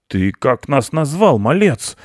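offended uncle mouse; angry. A narrator's reading. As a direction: demand it with rising anger. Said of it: "рассердился дядя".

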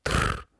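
short low rolled trill; a toneless rattle of the tongue; beetle trill. Acted. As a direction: buzz a low insect "trrr". Beetle answers.

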